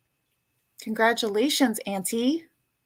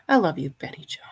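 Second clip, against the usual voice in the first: tender whisper; 5 semitones lower, faster than usual. Real, not acted. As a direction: whisper the love, then gush. Whispered affection to viewer.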